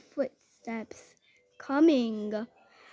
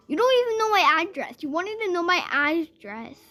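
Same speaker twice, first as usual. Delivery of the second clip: higher, louder retold protest; indignant retort; 6 semitones higher, 5 dB louder than usual. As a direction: snap back at a caller, indignantly. Retold angry retort to threatening caller.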